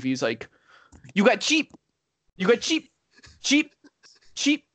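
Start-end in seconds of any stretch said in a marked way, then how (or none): 1.1-4.6 high-pitched mock Indian accent, short repeated demands; imitated Indian accent. Retelling a rude customer pestering Steve at the counter, mock Indian accent. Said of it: "he's just yelling at Steve".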